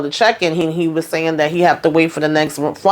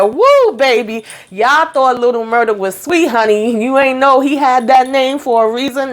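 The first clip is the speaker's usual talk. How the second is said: sharp high whoop, then a raised, hyped-up voice; excited whoop; 5 semitones higher than usual. Retold or imitated. Recapping to followers the killer's twist, whooping with excitement. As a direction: hype up the shock twist like you're cheering. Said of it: "I said".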